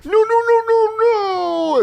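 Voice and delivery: very high, loud hammed-up wail; mock anguished denial. Man learning he caused the fire, hammed-up anguished wail. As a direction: wail a string of mock-desperate refusals. Acting out imagined tragic movie scene.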